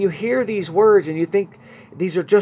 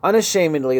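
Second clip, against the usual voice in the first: usual speaking voice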